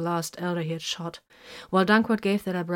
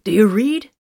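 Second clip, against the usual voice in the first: loud, higher call over the comm; 4 semitones higher, 11 dB louder than usual. Acted. Hiron checks in with the squad over the comm, loud. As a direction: push it out loud to reach the squad.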